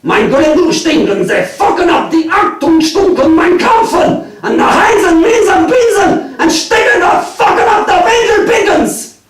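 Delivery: mock German gibberish, high and loud; weird German accent. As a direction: rant in a hammed-up fake German accent. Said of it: "talking in a weird German accent".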